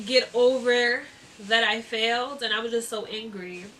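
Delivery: ordinary talk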